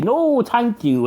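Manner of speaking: slow, high-pitched sarcastic politeness. Acted. Cat politely refusing the barman, rather sarcastic. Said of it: "in a rather sarcastic tone".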